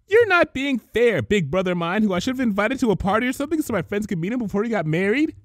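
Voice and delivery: lightly voiced precise Twilight; imitating Twilight. Pinkie playing Twilight scolding her big brother. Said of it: "In an imitation of Twilight's precise diction".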